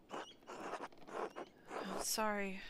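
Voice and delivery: drawn out in a breathy whisper; slow whisper. Real, not acted. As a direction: breathe it very slowly. Reading game line.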